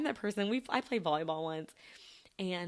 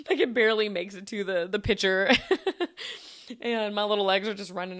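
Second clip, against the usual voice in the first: louder, laughing through the words; 2 semitones higher, 8 dB louder than usual. Real, not acted. Player mocking her own short kick, laughing. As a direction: let laughter spill into the punchline.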